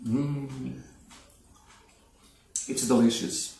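ordinary delivery

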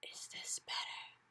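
very soft slow whisper; under her breath. Acted. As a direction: barely breathe the words. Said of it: "speaking right under her breath".

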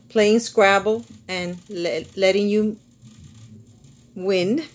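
measured, lilting poem recital with pauses between lines; poetry reading. Acted. Author reading a love poem to viewers, lilting poetry reading. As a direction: read it as a love poem, line by line.

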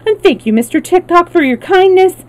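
high-pitched grateful gush; obliging, eager character voice; eager thanks. A narrator's reading. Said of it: "replied the Wheeler eagerly".

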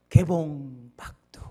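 slow booming trailer voice; old trailer announcer. Retold or imitated. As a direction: announce it like a vintage film trailer. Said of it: "꼭 이런 말을 했었습니다".

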